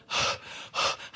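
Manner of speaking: long, breathy, worn-out sigh; exhausted sigh. Retold or imitated. Herself struggling uphill in the snow, heavy sigh. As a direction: let out a spent sigh.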